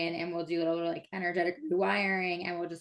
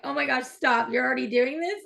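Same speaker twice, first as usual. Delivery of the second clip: amazed exclaim; 5 semitones higher, 6 dB louder than usual. Retold or imitated. Mom amazed at toddler son.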